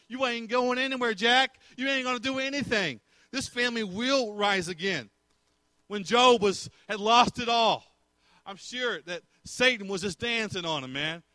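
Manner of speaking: high, defiant sermon delivery; fired-up preaching. Real, not acted. Preacher declaring this family will rise, impassioned preaching. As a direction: hurl it at the enemy.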